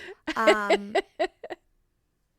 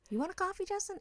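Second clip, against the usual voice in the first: high, sing-song waiter voice; high chirpy waiter; 10 semitones higher, faster than usual. Retold or imitated. Waiter chirping an offer of coffee to Justin, high. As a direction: chirp it up brightly like a server.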